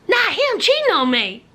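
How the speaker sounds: ordinary talk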